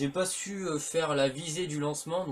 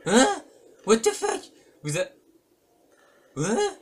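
real high, loud yelps of disbelief; startled; 8 semitones higher, 10 dB louder, slower than usual. Real, not acted. Player reacting to a shock in the game, startled. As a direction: blurt it out, shocked and much higher.

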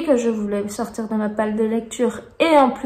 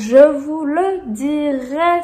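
slow, deliberate repetition; slowly spelled-out insistence; drawn-out promise; 4 semitones higher, 3 dB louder, much slower than usual. Real, not acted. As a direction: stretch every syllable for emphasis.

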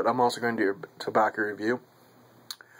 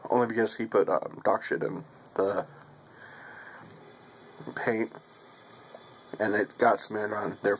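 talking around pipe stem; pipe in mouth. Real, not acted. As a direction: lips clenched on pipe. Seen 0: Pipe stem held in mouth corner throughout the labelled words.

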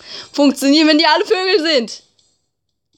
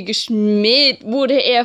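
ordinary talk, nothing marked

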